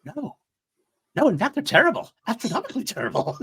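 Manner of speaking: mock the droid's horrified disbelief; squeaky high-pitched droid impression, appalled; imitating Huyang. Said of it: "it's all on David Tennant's delivery".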